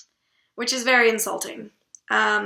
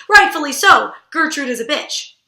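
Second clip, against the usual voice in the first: ordinary talk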